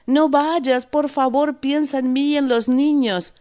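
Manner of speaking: high, loud begging wife's voice; desperate pleading. A narrator's reading. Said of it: "suplica su mujer".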